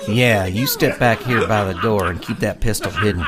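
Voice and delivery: lawman deputy. Acted. Deputy gives orders before standoff.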